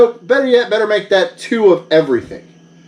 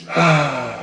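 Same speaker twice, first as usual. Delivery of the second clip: defeated sigh; 4 semitones lower, 4 dB quieter than usual. Acted. After hearing the price.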